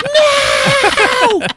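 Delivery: very high, loud, drawn-out shriek; mock scream. Comic playing the woman the monster grabs, high mock scream. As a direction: wail it like a hammed-up damsel. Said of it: "She's like".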